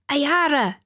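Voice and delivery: called out high and sharp across the hill; shouting a name. Acted. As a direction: yell for the squad leader, pitched high. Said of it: "she shouted".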